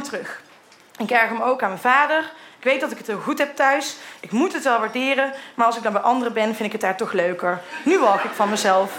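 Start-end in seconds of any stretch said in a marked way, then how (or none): none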